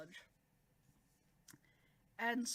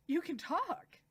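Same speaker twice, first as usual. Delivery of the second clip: higher, astonished exclamation; amazed outburst; 7 semitones higher, 4 dB louder, slower than usual. Retold or imitated. Subaru realises she can talk, loudly amazed. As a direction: burst out with it, astounded.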